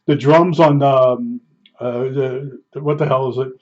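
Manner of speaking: normal talking voice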